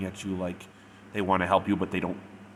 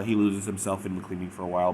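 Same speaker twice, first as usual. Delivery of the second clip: ordinary delivery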